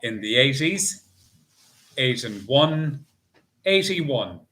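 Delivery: slow sing-song number calling; bingo caller. Acted. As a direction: call each ball clearly, drawn out.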